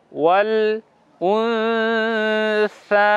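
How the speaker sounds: উনসা with the সা held long, nasal before it; drawn-out recitation. Shown as a demonstration. Teacher reciting ওয়াল উনসা with the long pull. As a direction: stretch the সা for a full alif. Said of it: "সেভাবেই বলতে হবে".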